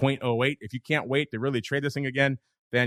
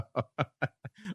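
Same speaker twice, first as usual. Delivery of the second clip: laugh; 4 semitones higher, 7 dB quieter than usual. Real, not acted. Laughing at rough trade.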